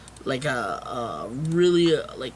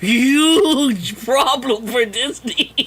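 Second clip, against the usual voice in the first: slow, loud, higher "huge" stretched out; stunned excitement; 5 semitones higher, 5 dB louder, much slower than usual. Real, not acted. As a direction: drag out "huge" in loud disbelief. Excited disbelief about Disney.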